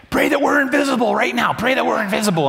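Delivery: high-pitched frantic urging; urgent plea. Retold or imitated. Urging kids to pray in car.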